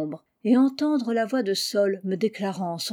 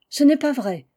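higher, louder snapping denial; sharp retort; 5 semitones higher, 6 dB louder than usual. A narrator's reading. Nell denies being engaged to her cousin, a sharp loud retort. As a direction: fire it straight back. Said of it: "ripostai-je".

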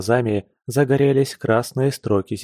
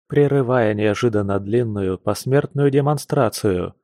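usual speaking voice

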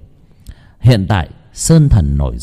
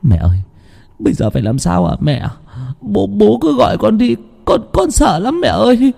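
put-on higher, trembling child's plea; a scared son asking his mother what to do; frightened boy; 11 semitones higher than usual.

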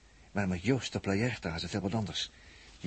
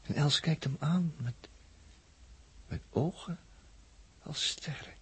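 awed hush; 5 semitones higher, 5 dB quieter, slower than usual. Acted. Describing Els with awe.

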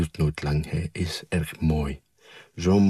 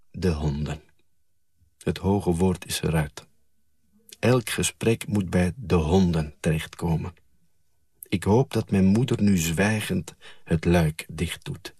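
plain reading voice

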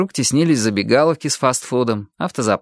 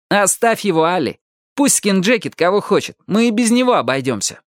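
higher-pitched, urging interjection; butting in; 7 semitones higher than usual. A narrator's reading. Companion tells Ali to drop it, interjecting. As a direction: cut in and urge her away.

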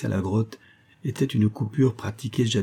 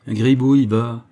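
sardonic retort; 2 semitones higher, 6 dB louder than usual. A narrator's reading. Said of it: "riposta sardoniquement le forçat". (réplique sardonique, plus forte)

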